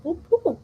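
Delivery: high little boop, boop like a game sound effect; imitated boop noise. Telling a friend about a game, a high imitated boop. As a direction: copy the game's chat blip with your voice. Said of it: "boop noise".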